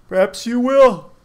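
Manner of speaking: high, loud yawn stretched through the words. A narrator's reading. The man agrees with Milo, yawning. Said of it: "agreed with a yawn".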